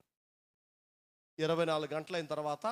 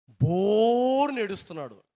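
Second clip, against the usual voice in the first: 1 loud lament; 4 semitones higher, 11 dB louder, slower than usual. A narrator's reading. Telling the old man wept.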